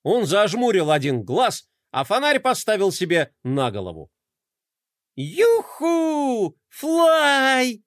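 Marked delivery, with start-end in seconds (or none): 5.2-7.8 delighted cheer. A narrator's reading. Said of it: "Глория подпрыгнула от восторга".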